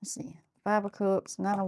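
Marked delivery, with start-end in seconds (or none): 0.0-0.3 hushed whisper to herself. Real, not acted. Reader whispering to herself over the cards, hushed. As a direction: breathe it, barely voiced.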